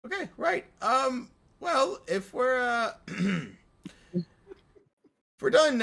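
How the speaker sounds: prissy, impatient little demon; Pip the imp. Acted. GM voicing Pip to the party, high and peevish. GM voices Pip, composed but annoyed.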